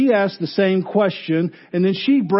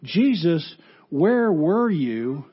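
where were you asked slowly; Martha's slow question to Jesus; much slower than usual. Acted. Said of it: "Martha comes to Jesus asking".

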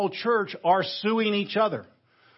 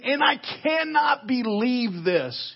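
high, slow cry of disbelief; stunned and indignant, stretching each word; 6 semitones higher, slower than usual. Acted. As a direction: exclaim it as if appalled. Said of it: "He's just stunned".

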